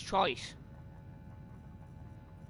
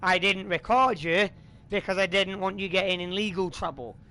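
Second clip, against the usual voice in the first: loud, higher furious accusation; angry; 4 semitones higher, 6 dB louder than usual. Real, not acted. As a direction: call him out hard. Angrily exposing a troll.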